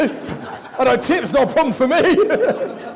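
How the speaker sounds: loud, slow, high words through laughter; laughing. Real, not acted. Speaker joking to audience about tipping, laughing. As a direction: laugh through a joke at yourself.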